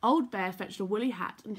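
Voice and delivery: ordinary delivery, nothing marked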